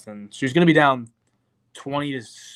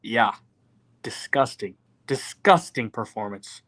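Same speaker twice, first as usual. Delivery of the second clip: ordinary talk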